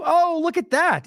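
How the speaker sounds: slow, high "oh" of delighted surprise; drawn-out gotcha, catching her out; surprised discovery. Real, not acted. Vlogger finding the old video, surprised and gloating. Spots the proof on screen.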